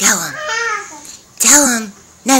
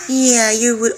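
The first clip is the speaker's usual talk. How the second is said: ordinary delivery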